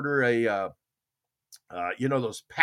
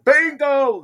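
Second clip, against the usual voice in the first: high, loud shout; yelled bingo; 13 semitones higher, 8 dB louder than usual. Retold or imitated. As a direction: belt it out to the whole room. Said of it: "They yell it loud".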